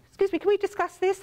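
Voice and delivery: normal talking voice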